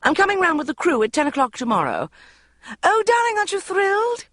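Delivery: high, loud mother gush; thrilled and overflowing, very high. A narrator's reading. Mother announcing Bridget's TV spot, high gushing.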